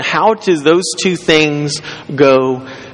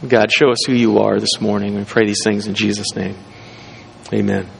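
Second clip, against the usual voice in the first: hushed prayer before the sermon; 5 semitones lower than usual. Acted. Opening prayer.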